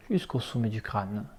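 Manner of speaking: usual speaking voice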